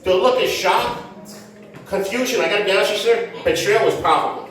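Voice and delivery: usual speaking voice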